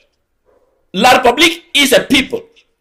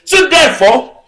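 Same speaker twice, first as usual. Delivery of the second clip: raised, higher, declaiming to the crowd; impassioned political oratory, pitched up; fiery rally speech; 5 semitones higher than usual. Real, not acted. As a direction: rouse the crowd, hammering each phrase. Rally speech at full force.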